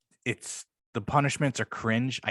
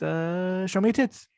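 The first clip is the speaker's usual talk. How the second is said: high mimicked voice with a long held "uh"; Sal's hesitant delivery; 8 semitones higher, slower than usual. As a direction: hold the "uh", then jump higher on the punchline. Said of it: "laughing at how Sal delivered that".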